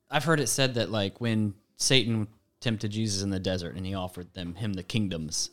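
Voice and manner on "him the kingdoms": ordinary talk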